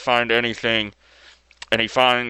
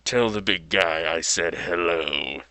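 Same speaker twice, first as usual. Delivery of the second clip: menacing Joker; 5 semitones lower than usual. Retold or imitated. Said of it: "most awesome and menacing lines the Joker".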